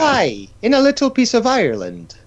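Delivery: high, put-on Irish accent. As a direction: play a cheeky Irish leprechaun. Mock Irish voice in banter.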